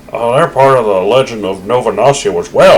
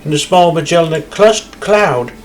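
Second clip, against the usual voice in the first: bright-eyed game guide character; cheerful tour guide; 6 semitones higher than usual. Acted. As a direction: sell the observatory like an eager guide. Reads game guide dialogue.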